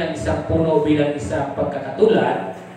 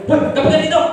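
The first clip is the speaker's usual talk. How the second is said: rough shout; 8 semitones higher, 3 dB louder, faster than usual. Retold or imitated. Rude shouted coffee invitation.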